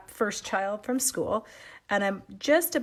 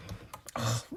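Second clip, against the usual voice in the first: gasp; 8 dB quieter than usual. Real, not acted. Sudden delight at a new product.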